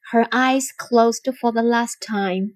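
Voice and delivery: plain reading voice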